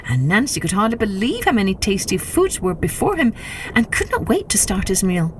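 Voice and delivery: usual speaking voice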